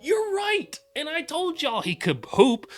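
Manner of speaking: high mocking voice. Answering in the high voice.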